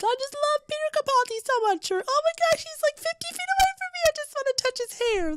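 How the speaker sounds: very high, giddy squealing fan; fangirl squeal. Retold or imitated. Teen fan squealing over Peter Capaldi nearby, high excited squeal. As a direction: squeal like a starstruck teenager. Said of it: "squealing about the fact".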